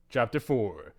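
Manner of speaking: ordinary talk, nothing marked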